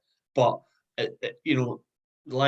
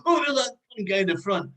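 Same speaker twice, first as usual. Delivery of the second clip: higher, teasing comeback; mock banter reply; 9 semitones higher, faster than usual. Band member bantering with a heckler, mock reply. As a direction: fire a cheeky answer back at the heckler.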